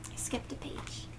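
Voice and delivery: quiet whisper to herself; whispered aside. Real, not acted. Reader notices skipped page, whispered aside. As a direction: mutter the slip under your breath.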